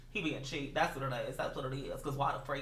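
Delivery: low, hushed snide remark; mock-confidential aside. Gossiping to viewers about cheap roommate, low and hushed. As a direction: drop right down as if he mustn't hear.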